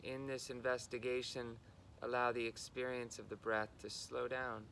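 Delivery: unhurried guidance, level in pitch, pausing between phrases; guided meditation. Real, not acted. Guiding listeners into body and breath, calm meditation delivery.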